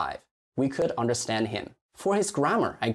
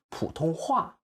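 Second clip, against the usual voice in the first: 普通话 with weight on 话; correct stress; 4 semitones higher than usual. Shown as a demonstration. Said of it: "It should be 普通话".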